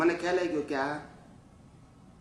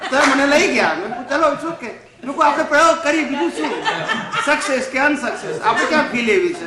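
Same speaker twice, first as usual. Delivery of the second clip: higher and louder, laughing through the words; 9 semitones higher, 9 dB louder than usual. Real, not acted. Retelling his reply to the family, laughing. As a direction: tell it while chuckling.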